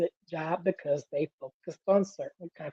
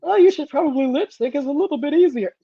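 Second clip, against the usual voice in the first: high, loud mocking studio exec; 9 semitones higher, 6 dB louder than usual. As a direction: mimic a pushy studio suit. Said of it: "you know how studio folks".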